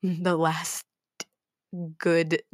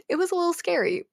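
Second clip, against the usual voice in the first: ordinary delivery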